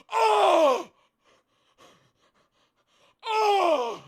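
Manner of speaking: high, loud drawn-out "oh"; hammed-up agonised howl; scream of pain. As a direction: howl as if maimed. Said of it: "I go".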